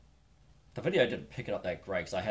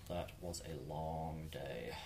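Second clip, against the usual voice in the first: weary slow quiet; 2 semitones lower, 9 dB quieter, much slower than usual. Shown as a demonstration.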